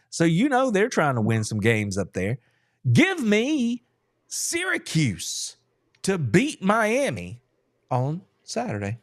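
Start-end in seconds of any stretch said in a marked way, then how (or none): 2.9-9.0 drawn-out pick announcement. Acted. Making the pick, stretched out.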